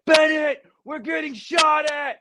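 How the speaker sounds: high-pitched shout of alarm; retold panicked shout. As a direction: yell it out as if under fire. Retelling buddy yelling under fire.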